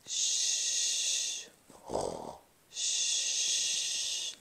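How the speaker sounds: long soft hushing exhale; pretend sleeping breaths. Showing children how to sleep, soft sleeping breaths. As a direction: breathe out slowly as if fast asleep. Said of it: "Can you show me sleeping?".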